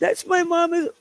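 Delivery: high-pitched mimicry of the woman; exaggerated high copy of her retold cry; mocking imitation. Host mimics the clip just played.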